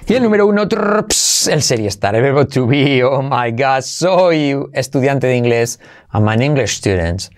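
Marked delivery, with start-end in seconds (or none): 0.7-1.5 higher rolled "trrr" ending in a "ps" cymbal; imitated drumroll. Teacher announces number one to learners, vocal drumroll. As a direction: mouth a drumroll and crash.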